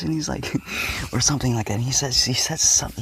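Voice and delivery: normal talking voice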